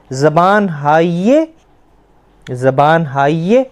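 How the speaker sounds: ज़बान हाय stretched out as zabaan haaye; slow spelled-out demo. Shown as a demonstration. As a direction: draw out हाय into haa-ye slowly. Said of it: "हम छोटी ये लगाएंगे".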